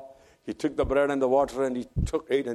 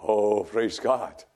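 usual speaking voice

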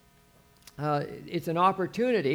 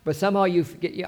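ordinary talk, nothing marked

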